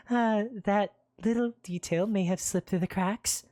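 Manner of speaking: put-on high, nervous, sheepish girl. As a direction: admit it with embarrassed laugh. London embarrassed.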